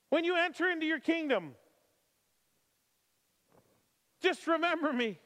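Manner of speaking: high, loud preaching. Acted. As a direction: plead the criminal's words fervently. Preaching at full force.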